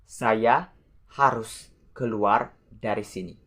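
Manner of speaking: word by word with pauses; measured example, phrase by phrase. Shown as a demonstration. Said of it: "The example".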